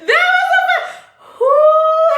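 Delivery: high, breathless laughter breaking through words; can't get it out for laughing; laughing fit. Real, not acted. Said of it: "I gotta calm down".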